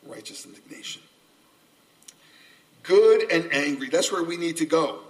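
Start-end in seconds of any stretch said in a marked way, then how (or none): 0.0-1.0 hushed, lower, breathed out; whispered term. Acted. Preacher whispering the key term to listeners. 2.8-3.9 louder, punched out; emphatic call. Acted. Preacher rallying the congregation, louder.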